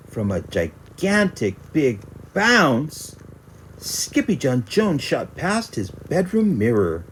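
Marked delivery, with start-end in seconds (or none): none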